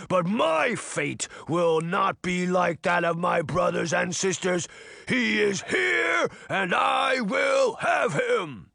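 drawn-out, shouted giant voice; growling giant. A narrator's reading. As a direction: rage slowly as a vengeful giant. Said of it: "the giant growled".

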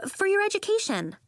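put-on high-pitched young woman's reassurance; high, encouraging sisterly voice; reassuring sister. A narrator's reading. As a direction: soothe the upset child in a high voice. Sister reassures upset child.

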